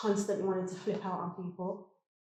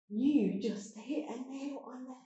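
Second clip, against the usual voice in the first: moved; 6 semitones higher, 3 dB quieter, slower than usual. Real, not acted. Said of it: "I didn't expect emotion to just surface".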